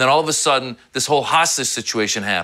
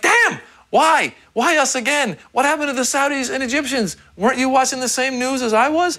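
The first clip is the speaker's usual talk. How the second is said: exasperated outburst; 11 semitones higher than usual. Retold or imitated. Reacting to the axis of evil, high exasperated wail. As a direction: complain to Bush in a high wail. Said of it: "I'm like".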